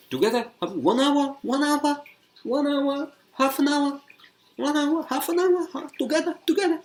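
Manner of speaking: put-on very high squeaky Chinese accent, loud; shrill confused questions in a Chinese accent. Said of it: "she was like".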